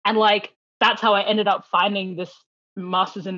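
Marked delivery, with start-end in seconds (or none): none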